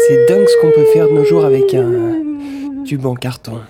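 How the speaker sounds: very high, loud voice through a cardboard tube. Acted. Avec un tube en carton.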